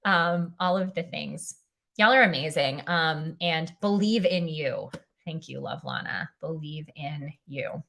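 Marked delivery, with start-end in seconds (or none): 5.2-7.8 whispered. Real, not acted. Repeats chat words to herself.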